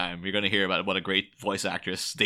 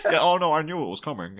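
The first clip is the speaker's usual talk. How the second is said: higher, lightly put-on impression voice; mock knowing reply; 7 semitones higher than usual. Podcaster imagining the actor caught out, higher mock voice. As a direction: act out the actor's slip into a silly voice. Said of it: "people would be like".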